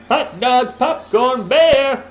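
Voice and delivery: loud, high ballpark vendor call; stadium vendor. Acted. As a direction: hawk snacks up the bleachers. Hawking snacks like a vendor.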